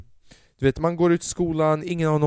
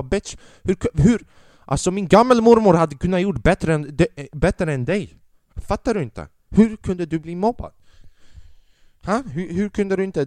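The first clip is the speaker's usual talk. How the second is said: mock angry rant; 6 semitones higher, 5 dB louder than usual. Father berates bullied son.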